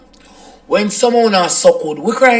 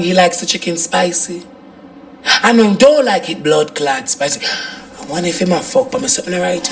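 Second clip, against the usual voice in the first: usual speaking voice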